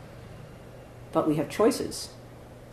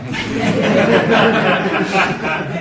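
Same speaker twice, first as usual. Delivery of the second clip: big real laugh, louder; loud burst of laughter; 6 semitones higher, 11 dB louder than usual. Real, not acted. Laughing at own joke.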